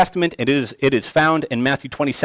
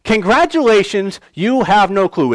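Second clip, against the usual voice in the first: raised, higher mock congratulation; sarcastic; 6 semitones higher, 4 dB louder than usual. Jesus to those who think they know him, sarcastically. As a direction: congratulate them with loud, open sarcasm. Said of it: "there is sarcasm there".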